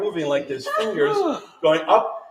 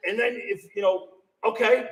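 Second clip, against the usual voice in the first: ordinary talk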